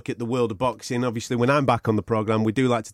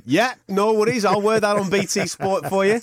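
eager yes-man voice; 8 semitones higher than usual. Retold or imitated. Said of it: "you'll be going".

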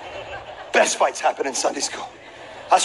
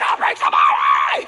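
mock crazed threat. Crying boy threatens the whole class, low shout. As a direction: threaten the room like a wild kid.

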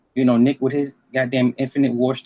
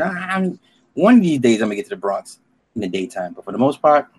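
normal talking voice

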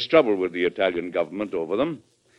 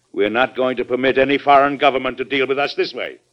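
raised, louder, resolute declaration; firm defiance; 4 semitones higher, 5 dB louder than usual. Acted. President to Secretary about foreign government pressure, firm defiance. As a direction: put your foot down firmly.

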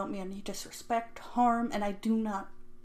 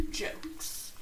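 breathy throwaway whisper; quick whispered aside; whispered jokes; 7 dB quieter than usual. Real, not acted. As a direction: toss it off under your breath. Aside after laugh.

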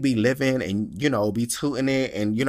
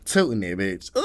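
normal talking voice

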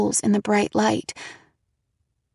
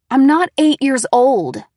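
loud, hurt retort; angry retort; 5 semitones higher, 8 dB louder than usual. A narrator's reading. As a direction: snap back at mom. Daughter's anger growing.

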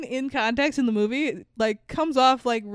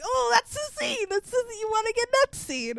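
excited; 10 semitones higher than usual. Real, not acted. Excited recognition of the scene.

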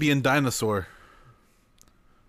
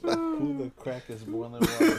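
high, laughing repeat of the line; 14 semitones higher, 4 dB quieter than usual. Retold or imitated. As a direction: quote it while cracking up. Repeating friend's line, cracking up.

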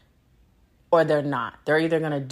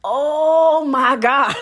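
exasperated; 7 semitones higher, 7 dB louder, much slower than usual. Real, not acted. Exasperated outburst.

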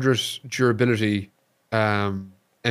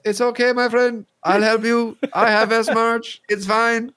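higher, louder friendly broken-English voice; 11 semitones higher, 3 dB louder than usual. Retold or imitated. Friendly scav reassuring wounded player, broken English. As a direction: play the kind foreign player offering help. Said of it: "this broken English man".

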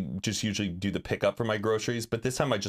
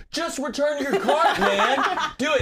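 higher, louder mock yell; laughing yell; 13 semitones higher, 4 dB louder than usual. Fan yelling at the shopper to return the cart. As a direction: egg him on loudly. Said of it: "I kinda yell at the guy".

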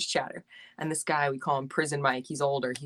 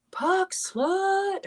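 mock teammate chirp. Boys chirping her at rink.

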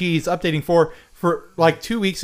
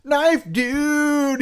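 high, far-off announcer cry; mock announcer voice; hype announcer; 13 semitones higher than usual. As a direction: call it out like a ring announcer. Announcing Knife Dude.

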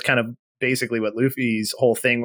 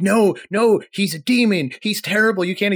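panicked Koby; 7 semitones higher than usual. Retold or imitated. Koby begging Luffy not to seek the demon, high frantic plea. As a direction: plead him out of it in a rush of alarm.

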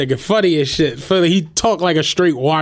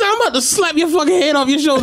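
mock threat; 10 semitones higher than usual. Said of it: "He's like".

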